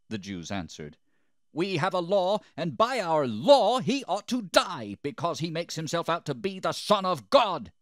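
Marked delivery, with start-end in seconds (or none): 1.5-7.7 high, loud indictment; vehement accusation. A narrator's reading. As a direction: shout the charge as an outraged crowd.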